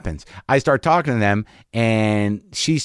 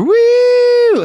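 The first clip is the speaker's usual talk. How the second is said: loud high whooping woo; imitated woo; 7 dB louder than usual. Comic demonstrating the loud high woo again. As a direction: whoop like a fan in distress.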